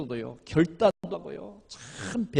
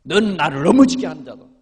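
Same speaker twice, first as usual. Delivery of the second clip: loud mock reprimand; retold rebuke; 11 dB louder than usual. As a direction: accuse firmly, quoting a scolding. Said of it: "이런 책망도 받았다니까".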